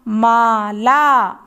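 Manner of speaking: ताला drawn out very slowly; slow reading demo for children; slow blending. Shown as a demonstration. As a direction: read ताला slowly syllable by syllable. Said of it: "एक साथ मिलाकर पढ़ेंगे".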